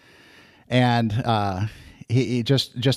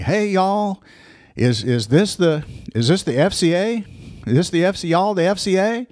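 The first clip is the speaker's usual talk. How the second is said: put-on friendly Alabama coach greeting in a Southern accent; 6 semitones higher than usual. Coach walks into the Bible study, asking in a Southern accent. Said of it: "with this big Alabama Southern accent".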